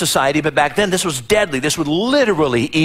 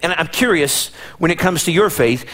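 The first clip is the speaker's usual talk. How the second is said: ordinary talk, nothing marked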